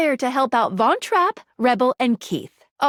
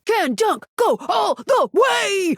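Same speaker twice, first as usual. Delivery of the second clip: high, drawn-out excited shout; slow, high-pitched rooting shout; sports cheer; 8 semitones higher, slower than usual. Acted. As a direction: shout it like a play-by-play climax. Acted sports cheer for Dunk.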